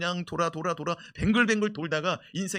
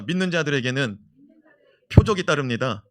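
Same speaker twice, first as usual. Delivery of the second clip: loud declaration; 9 dB louder than usual. Shown as a demonstration. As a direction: proclaim 믿는 loudly, pausing before 따릅니다. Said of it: "선포합시다". (크게 선포하듯)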